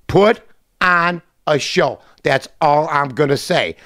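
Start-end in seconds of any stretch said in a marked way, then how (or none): 0.1-2.0 each word of "Put on a show" stretched out; slow, drawn-out repeat. Real, not acted. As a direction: savour "Put on a show" slowly.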